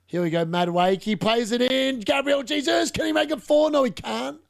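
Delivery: building then dropping off; excited commentary. Real, not acted. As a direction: call the chance as it unfolds. Live chance in front of goal.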